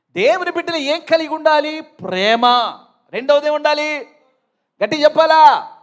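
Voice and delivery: loud, high fiery preaching; high-pitched shouted sermon calls; preaching at full force. Acted. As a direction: rouse the congregation with loud calls.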